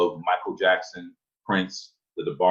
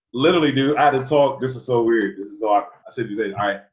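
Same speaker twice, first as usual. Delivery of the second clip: ordinary delivery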